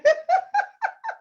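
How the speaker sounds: loud high squeal of laughter. Real, not acted. Host joking about nearly crying, loud high laugh.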